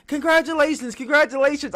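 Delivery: high, loud, giddy congratulations; giddy Tony Khan. Retold or imitated. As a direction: gush like an innocent, delighted boss. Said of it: "so, like, happy and giddy".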